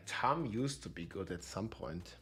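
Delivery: ordinary talk, nothing marked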